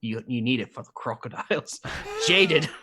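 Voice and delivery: hammed-up Australian, laughter creeping in at the end; Australian accent. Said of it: "Just go Australian".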